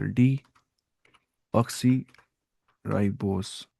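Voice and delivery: very drawn-out word with long gaps between parts; slow, syllable by syllable. Real, not acted. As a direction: say it piece by piece, as if typing along. Spelling out a search term while typing. (Type करते हुए धीरे-धीरे, टुकड़ों में)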